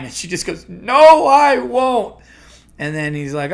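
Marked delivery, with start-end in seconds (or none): 0.7-2.2 loud, high mock wail; hammed-up inconsolable cry of protest; distraught caller. Caller rejects the host's comfort, mock distraught.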